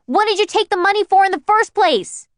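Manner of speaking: high, loud, exasperated near-scream; almost scream it at him. Acted. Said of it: "I practically screamed".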